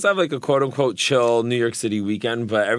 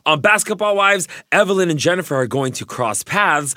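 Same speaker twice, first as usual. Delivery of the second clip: ordinary talk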